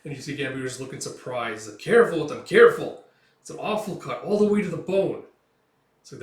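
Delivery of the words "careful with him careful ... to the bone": loud, high urgent call; urgent warning. Acted. Mercenary tending the wounded kid, loud urgent warning. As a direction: urge care for the wound.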